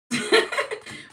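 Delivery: loud, high burst of laughter; short, loud, amused laugh; real laugh. Real, not acted. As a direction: crack up briefly at yourself. Laughs at own smoker remark.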